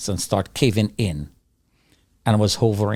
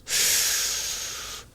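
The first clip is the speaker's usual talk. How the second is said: long rushing whoosh of escaping air; imitated suction whoosh; 8 dB quieter than usual. Survivor mimics air sucked from window panes, imitated whoosh. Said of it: "You can hear the sound".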